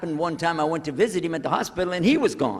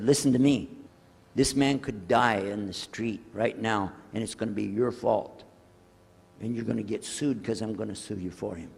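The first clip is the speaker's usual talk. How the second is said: stern threat; 6 semitones lower than usual. Retold or imitated. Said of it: "I got in the lady's face".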